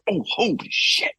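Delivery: high, stunned exclamation; invested shock. Retold or imitated. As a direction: gasp at a plot twist. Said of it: "so invested and be like".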